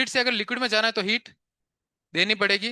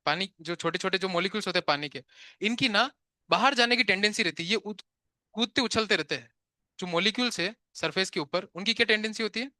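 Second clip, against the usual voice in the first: ordinary delivery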